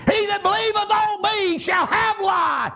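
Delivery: preacher's sustained cry quoting scripture; ringing proclamation. Retold or imitated. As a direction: proclaim the promise on one held note. Preacher proclaims words of Jesus.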